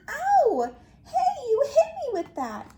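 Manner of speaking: high, loud indignant protest. Acted. Yeti hit by Mad's rock, protesting loudly. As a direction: call out sharply after being hit.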